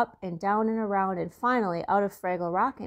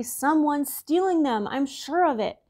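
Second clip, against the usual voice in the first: a touch of anger, higher and slower; mildly angry Boober; 7 semitones higher, 3 dB louder than usual. A narrator's reading. Boober grumbles to Wembley, mild angry edge. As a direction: Boober complains about stolen socks, a bit angry. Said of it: "Boober said angrily".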